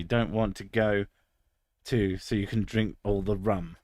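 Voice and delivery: usual speaking voice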